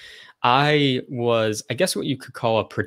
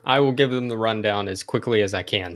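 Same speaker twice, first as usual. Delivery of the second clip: ordinary talk, nothing marked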